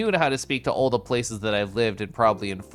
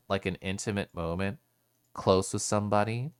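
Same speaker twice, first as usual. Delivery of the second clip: much softer, hushed and close; very quiet; 3 semitones lower, 5 dB quieter than usual. Shown as a demonstration. Showing chat how she talks in intimate moments, softly. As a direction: drop right down as if leaning in close. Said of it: "we'll just make it very quiet".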